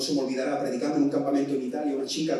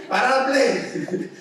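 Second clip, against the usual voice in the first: higher, louder slow shout through a laugh; laughing parent yell; 9 semitones higher, 6 dB louder, much slower than usual. Retold or imitated. Preacher repeats the parent's order laughing, loud yell. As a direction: yell it while cracking up.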